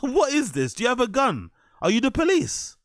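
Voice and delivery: high-pitched mocking disbelief; mock the question in a high voice; incredulous mockery. Reactor mimicking the storyteller's gun question, incredulous mockery.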